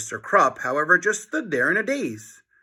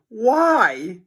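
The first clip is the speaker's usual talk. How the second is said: anguished cry; 8 semitones higher, 5 dB louder than usual. A narrator's reading. Harold despairs.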